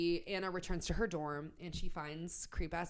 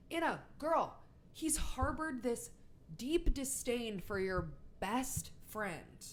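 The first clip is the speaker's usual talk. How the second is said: higher-pitched, drawn-out mock plea to a friend; incredulous girl-to-girl reality check; exasperated appeal; 5 semitones higher, slower than usual. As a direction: talk sense into a clueless girlfriend, pitched up. Reviewer pleads with fictional Anna.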